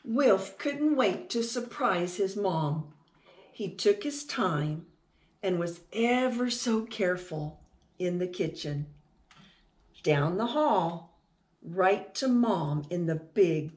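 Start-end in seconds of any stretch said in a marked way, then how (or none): none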